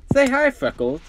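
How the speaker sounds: high, loud, cheerful cue to a pet; childlike high-voiced introduction; eager prompting. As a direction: coax a pet to greet, pitched high. Caboose cues his pet.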